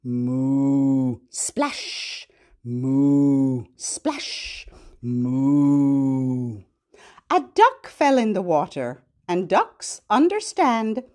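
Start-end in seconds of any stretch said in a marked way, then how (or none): 0.0-6.6 low long moo; mooing cow. Pretend. Teacher voices the cow who hates swimming. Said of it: "Cow says".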